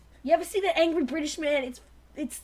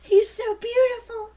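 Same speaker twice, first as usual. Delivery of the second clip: swooning fan; 6 semitones higher, 5 dB louder than usual. Pretend. Fangirl swoon.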